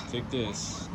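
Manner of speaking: ordinary delivery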